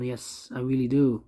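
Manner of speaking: ordinary talk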